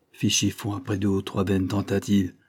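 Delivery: ordinary delivery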